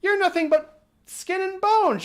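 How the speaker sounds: high, loud mock witch screech. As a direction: squeal it like a cackling old witch. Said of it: "she feels it and goes".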